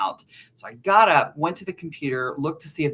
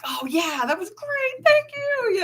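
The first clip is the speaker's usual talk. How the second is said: much higher, gushing thanks; delighted after a scare. Retold or imitated. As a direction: squeal out your thanks, thrilled. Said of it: "I'm like".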